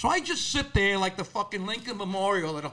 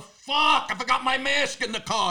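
higher, frustrated burst of cursing; exasperated outburst; 5 semitones higher than usual. Acted. Comic telling how he reaches the door maskless, exasperated. Acted frustration at forgotten mask.

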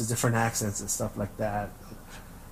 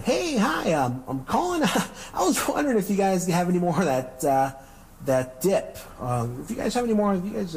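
high, chirpy phone-customer voice with little laughs; 7 semitones higher than usual. Acted.

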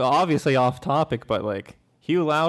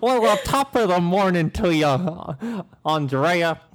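hammed-up stage Irish greeting; badly faked British accent gone Irish; fake Irish accent; 2 semitones higher than usual. Shown as a demonstration. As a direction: lay on a mock Irish lilt. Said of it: "that's Irish".

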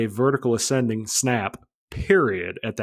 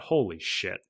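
softer, lower muttered reaction; stunned inner thought; 4 semitones lower, 7 dB quieter, slower than usual. Retold or imitated. Pilot thinking over the coach's order, quiet stunned aside. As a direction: say it under your breath, to yourself. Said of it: "And I thought".

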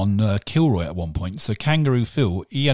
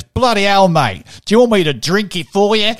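loud, high exasperated Aussie impression; proper Australian; 9 semitones higher, 8 dB louder than usual. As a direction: mimic a fed-up Australian café man, hammed-up. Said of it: "proper, proper Australian".

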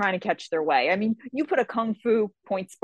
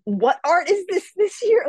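a slow, high, louder question; mock disbelief; 9 semitones higher, 5 dB louder than usual. Fighter asking a cadet which art he trained in, slow and high. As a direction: stretch it out in stunned disbelief. Stunned at surprise martial art.

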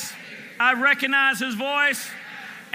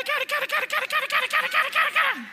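high squeaky gossiping voice, much higher, a laugh at the end; mock catty gossip; much faster than usual. Preacher mocking gossip for the congregation, high and squeaky. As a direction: squeak it out like petty chatter.

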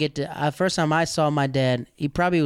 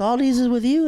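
amazed; 10 semitones higher, 4 dB louder than usual. Retold or imitated. Surprised at the group.

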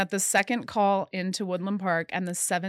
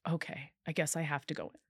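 softer, reluctant giving in; resigned agreement; 2 semitones lower, 8 dB quieter, faster than usual. Retold or imitated. Woman retelling how she gave in to the officer, resigned and soft. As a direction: retell it as a quiet, reluctant concession.

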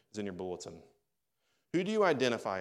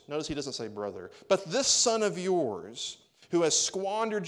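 resentful complaint; 6 semitones higher, 6 dB louder than usual. Acted. Older brother's angry complaint voiced.

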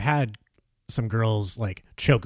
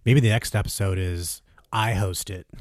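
usual speaking voice

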